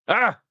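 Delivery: sharp high yelp of annoyance; frustrated shout. Real, not acted. Host yelping after fumbling the answer again, frustrated shout. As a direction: blurt out frustration at the mistake.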